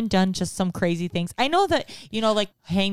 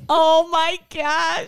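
laughing; 9 semitones higher, 5 dB louder, much slower than usual. Real, not acted. Reacting with laughing cry.

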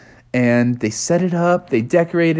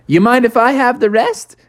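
much higher, hopeful beaver voice; Bucky the beaver; 10 semitones higher than usual. Acted. Bucky asking the frogs for the leftovers, high. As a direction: ask sweetly for more.

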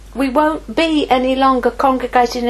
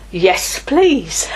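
laughing yes; 4 semitones higher than usual. Real, not acted. Laughing acceptance.

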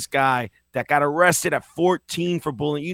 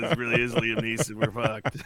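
mock terrified kidnapper; 2 semitones lower than usual. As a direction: whisper your doom like a caught crook. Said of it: "I would be like".

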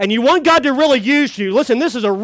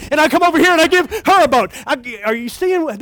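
ordinary talk, nothing marked

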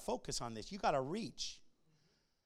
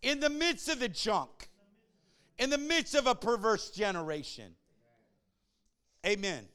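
raised preacher's voice, leaning hard on key words; forceful preaching; 7 semitones higher, 9 dB louder than usual. Acted. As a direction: drive the message home to the congregation.

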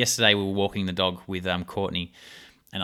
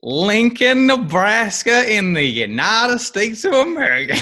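put-on loud, high, drawn-out American accent; mock American accent; 9 semitones higher, 7 dB louder, slower than usual. Host echoing guest's hometown, exaggerated American accent. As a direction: parrot it back in hammed-up American.

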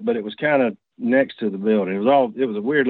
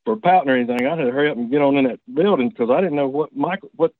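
ordinary delivery

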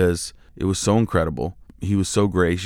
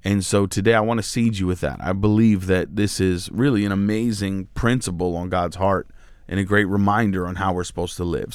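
ordinary talk

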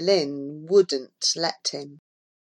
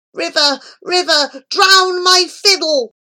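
high, impassioned plea, slowing at the end; crying out; 11 semitones higher, 6 dB louder than usual. A narrator's reading. Cursed boy begging the river, high impassioned cry. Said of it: "he cried out in his innermost soul".

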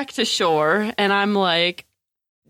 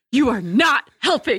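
higher, emphatic mock scolding; retold snap; 4 semitones higher than usual. Woman in pain to her unhelpful partner, snapping. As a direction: snap it, stressing "not". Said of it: "I snap at him".

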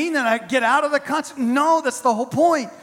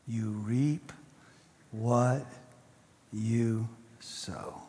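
slow, soft, sinking into a whisper; fading to a whisper; 11 semitones lower, 9 dB quieter, slower than usual. Acted. Preacher hushing the congregation, slowing to a whisper. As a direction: let it die away to a breath.

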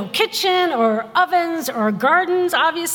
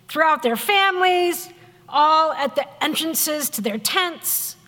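ordinary talk

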